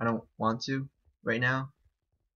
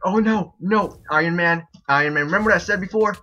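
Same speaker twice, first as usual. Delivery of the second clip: loud, raised-pitch protest; alarmed shouting; 5 semitones higher, 7 dB louder than usual. Real, not acted. Streamer pleads with Iron Man mid-game, loud alarmed shouting. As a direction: call out to a rival in dismay. Alarmed, calling out rival player.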